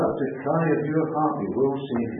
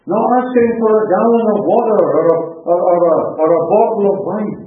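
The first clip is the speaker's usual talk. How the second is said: full-force preaching; 7 semitones higher, 11 dB louder than usual. Real, not acted. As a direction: proclaim it with long, impassioned phrases. Preacher dramatises man in hell.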